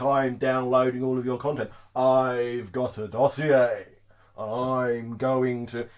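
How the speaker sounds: low, drawn-out mock menace; mock veiled threat. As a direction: threaten slowly, sending up the person. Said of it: "coveted threat like".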